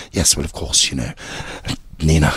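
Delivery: low-pitched oddball boss; weird character voice. Shown as a demonstration. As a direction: lightly play an eccentric in a low register. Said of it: "doing all this weird shit".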